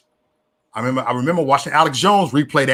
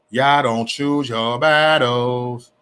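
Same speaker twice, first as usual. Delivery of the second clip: very slow, stretching "battles"; slow, emphatic scolding; drawn-out reproach; 4 semitones lower, much slower than usual. Real, not acted. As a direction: drag out each word, especially "battles".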